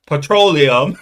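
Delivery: loud mock-British PETROLEUM; put-on British pronunciation; joking British accent. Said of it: "I was joking".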